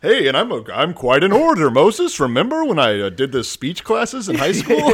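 high, loud, show-off brother voice; boastful Aaron. Retold or imitated. Aaron loudly bragging to Moses about his speaking. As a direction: brag like an eager sidekick.

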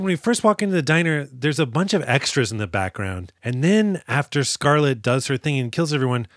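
ordinary delivery

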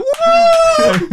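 very high, loud "woo"; mock whoop of triumph; celebratory whoop. Man imitating a jubilant crowd, high loud whoop. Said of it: "They're like".

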